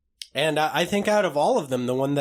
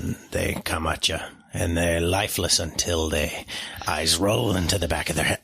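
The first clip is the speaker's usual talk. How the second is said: put-on low Quint accent, grim storytelling; low-voiced Quint accent, lightly done; Quint accent impression; 8 semitones lower than usual. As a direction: tell it like a scarred sea captain in Quint's accent. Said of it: "the Quint accent".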